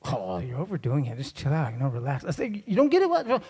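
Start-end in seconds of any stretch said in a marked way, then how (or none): 0.0-2.2 low, unbothered wife voice; lightly voiced wife telling him off; dismissive wife. 2.7-3.4 high, incredulous husband; comic indignant challenge; mock exasperation.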